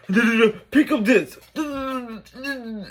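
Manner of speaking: a high mock brother snapping, then trailing off; mocking rude brother. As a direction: mimic the brother barking orders, high and sneering. Imitating rude brother on FaceTime.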